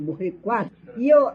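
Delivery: ordinary talk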